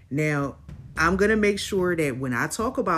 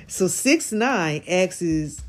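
normal talking voice

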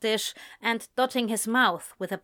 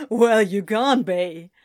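loud, dismissive amusement; laughing retort; 6 dB louder than usual. A narrator's reading. Friend refuses to believe him, retorting after laughter. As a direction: retort while coming down from a laugh. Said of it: "retorted as he started to come down from it".

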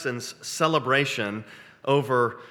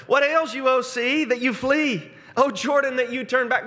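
high, loud, mocking jeer at the sea; taunting; 11 semitones higher, 4 dB louder than usual. Psalmist taunting the fleeing sea, high loud mockery. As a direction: tease the sea like a cocky winner. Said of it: "he starts taunting".

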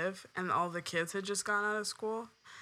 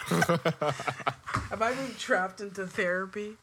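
laughing. Real, not acted. Joking after a personal question.

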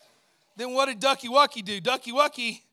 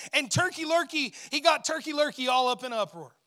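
ordinary delivery

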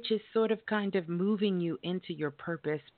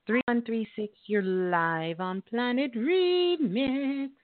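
radio host announcing; 5 semitones higher, 5 dB louder, slower than usual. Acted.

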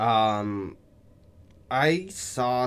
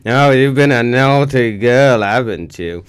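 Austin Powers; 3 semitones higher, 11 dB louder than usual. Pretend. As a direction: purr it like a flirty English spy. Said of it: "transitioned to Austin Powers".

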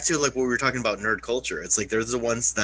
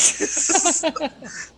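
sudden high cackle; high laughing outburst; high laugh burst. Real, not acted. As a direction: let out a squeaky burst of laughter. Laughs at own story.

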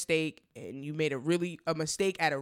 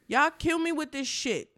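exasperated; 8 semitones higher than usual. Real, not acted. Repeats the outburst, high.

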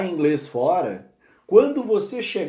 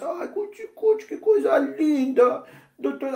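put-on high cooing baby talk; 10 semitones higher than usual. Adult cooing at a baby who speaks wrong, high and sweet. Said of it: "o que que a gente faz?".

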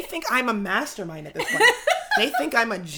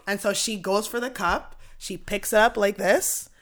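ordinary talk

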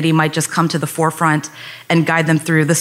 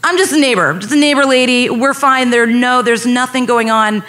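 higher-pitched, hurried-to-explain placating; anxious placating; 6 semitones higher than usual. Retold or imitated. Neighbour lady explaining herself to police, anxious placating. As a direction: placate him, voice lifted. Reassuring officer who yelled at her.

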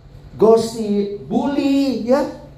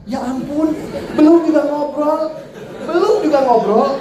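higher-pitched comic disbelief; mock exasperation; 6 semitones higher than usual. Preacher retelling his reaction to a horoscope rejection, mock exasperation. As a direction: protest incredulously, close to laughing.

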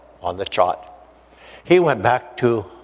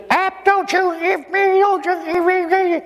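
pleading mother. Retold or imitated. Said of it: "She says".

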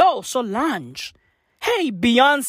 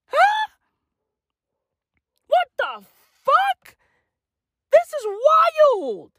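mock shock; slower than usual. Shocked reaction to the story.

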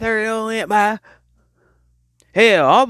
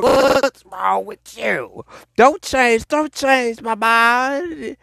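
scolding; 5 semitones higher, much slower than usual. Acted. Scolding imagined relative.

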